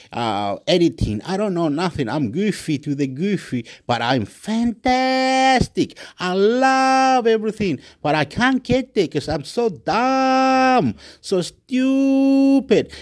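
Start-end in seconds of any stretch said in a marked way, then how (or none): 3.8-7.8 high, long-held exclamations; gushing and hammed-up, high pitched; over-the-top elation. Real, not acted. Hyping himself up, over the top. 8.0-12.9 high, drawn-out wail on each insult; hammed-up, stretched self-insults; exaggerated self-mockery. Real, not acted. Loudly mocking himself.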